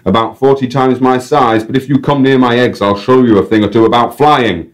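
high, loud, broad North English showman; North English guide. Acted. Guide hails the crow to the crowd, North English accent. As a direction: proclaim it like a cheeky North English compère. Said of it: "random North English accent".

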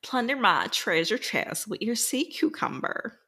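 Southern accent. Shown as a demonstration. Co-host taking up the bonus challenge, drawn-out Southern accent. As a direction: stretch it out in a playful Southern twang. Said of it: "do it in a Southern accent".

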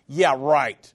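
P=A; sarcastic. Acted. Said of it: "They were mocking him".

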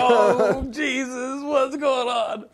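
high, loud and slow, laughing dismay; horrified. Retold or imitated. Geeky kid hearing about the bong, high slow dismay. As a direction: drag it out in shocked disbelief. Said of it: "And I was like".

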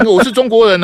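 loud, high, proud shout of the slogan; demonstrative shout. Shown as a demonstration. Host shows the caller he dares to shout it, loudly. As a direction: belt it out like a street rally. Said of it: "我现在就喊".

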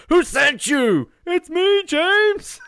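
high, loud, drawn-out demand and reply; hammed-up panicked yelling at an airport pickup; mock suspicious shouting. James Bond shouts at the relative collecting him from the airport, mock suspicious shouting.

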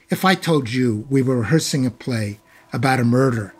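ordinary talk

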